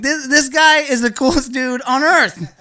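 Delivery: high-pitched, excited rave; gushing admiration. Retold or imitated. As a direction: gush as if completely won over. Gushing over the tow driver.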